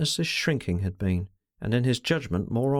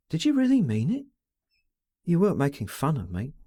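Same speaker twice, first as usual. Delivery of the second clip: a lightly voiced girl, stammering with feeling; young woman's voice; 2 semitones higher than usual. A narrator's reading. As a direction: voice the young woman, anxious and eager. She continues speaking.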